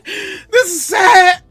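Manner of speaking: high, very slow wailed "sad"; mock crying. Fan moaning to viewers about the game, mock crying. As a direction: drag out "sad" like a mock sob.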